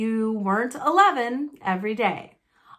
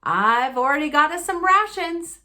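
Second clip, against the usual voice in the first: loud, higher, self-satisfied; proud Uncle Vernon; 6 semitones higher, 5 dB louder than usual. Acted. Uncle Vernon boasting to the family about rations, loud. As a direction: show off your planning.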